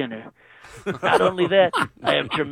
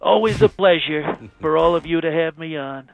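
channel a bragging president on the phone; Trump impression; 2 semitones lower than usual. President phoning into radio show, Trump-style boast.

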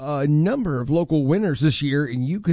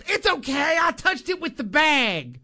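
imitating coworker; 8 semitones higher than usual. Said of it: "she goes".

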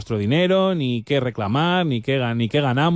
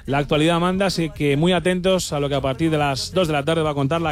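higher-pitched on-air announcer read; radio promo announcement; 4 semitones higher than usual. Acted. Radio host urges listeners to tune in at two, promo announcer style. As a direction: announce what is coming up on the radio.

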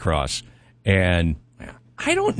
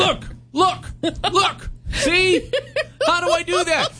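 high, insistent look look; mock driver; slower than usual. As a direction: plead with the deputy, high and laughing. Acting driver showing missing hand.

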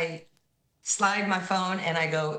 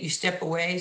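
usual speaking voice

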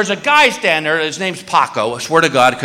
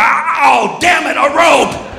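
be a shark foiled by a rope; frustrated shark voice; 8 semitones higher, slower than usual. Pretend. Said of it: "he's gonna get the rope and go".

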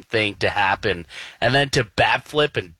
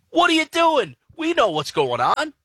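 exasperated outburst. Retold or imitated. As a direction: fire it at him in disbelief. Exasperated at producer's text.

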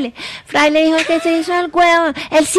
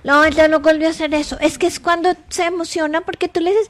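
ordinary delivery

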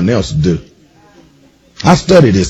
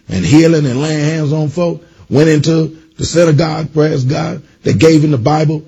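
higher, driving sermon delivery; excited pulpit storytelling; rising preaching; 5 semitones higher than usual. Acted. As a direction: preach it up, building momentum. Preaching builds to high pitch.

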